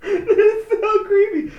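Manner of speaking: high, loud, slow giggled words; real cracking up; helpless laughing. Real, not acted. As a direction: squeeze words out through laughter.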